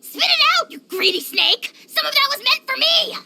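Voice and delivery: high indignant squawk; indignant outrage. Acted. Dragon lord protesting she ate his share, indignant. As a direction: protest like a cheated glutton.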